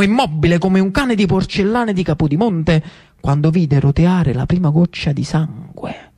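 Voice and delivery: usual speaking voice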